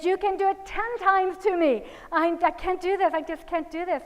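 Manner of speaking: put-on high, loud pleading of a child; imitated crying. Daughter begging mother not to make her hit, imitated crying. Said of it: "she started crying".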